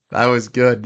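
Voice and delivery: laughing through That was good. Real, not acted. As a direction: say it still chuckling. Laughs at own Giannis joke.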